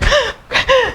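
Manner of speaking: sharp high gasp, near tears; sobbing gasp. Retold or imitated. Telling a new friend about the fallout, a high tearful gasp. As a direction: catch the breath like starting to sob.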